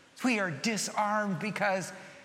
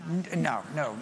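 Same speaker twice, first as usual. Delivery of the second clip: usual speaking voice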